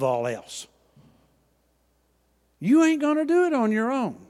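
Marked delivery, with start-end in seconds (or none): 2.6-4.3 loud, high burst of preaching; emphatic shout. Real, not acted. Preacher driving home his point, a loud high shout. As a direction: hit it hard and high to wake them. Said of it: "Excuse my Oklahoma English".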